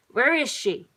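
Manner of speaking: angry parent calling out loudly; bellow. A narrator's reading. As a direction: bellow it, demanding an answer. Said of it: "he bellowed".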